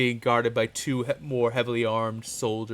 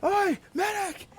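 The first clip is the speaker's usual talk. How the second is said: high, slow call across the field; soldier shouting. Acted. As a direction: bellow "Oi, medic!" at the wounded man. Soldier calling out.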